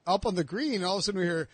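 usual speaking voice

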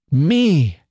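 high, swooping one-word exclamation; astonished cry. A narrator's reading. Annie hearing she is chosen, astonished cry. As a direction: cry ME out in disbelief. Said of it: "cried Annie".

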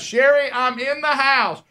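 high, loud sing-song announcement; loud announcing. Retold or imitated. Husband warning his wife he is coming in the house. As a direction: announce yourself so she won't scream. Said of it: "Then I step in the house".

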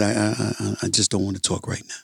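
mock sulking after hitting a deer; mock despondent mumble. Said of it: "I said".

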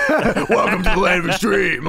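hyped-up, laughing announcer voice; mock announcer. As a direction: ham it up like an ECW promo. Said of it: "I was like".

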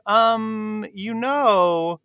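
high, drawn-out hesitant objection; mock polite correction. Host imitating a party guest about to correct the lyricist, mock hesitant. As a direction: stretch it out like a fussy interjection. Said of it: "he had somebody come and say".

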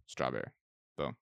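flat, certain one-word answer, low; deadpan answer. Pretend. Fan answers every flavor question with a low deadpan strawberry.

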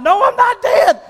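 high, exasperated mock yell; yelling back. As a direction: yell it back in acted annoyance. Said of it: "we both yell back at the same time".

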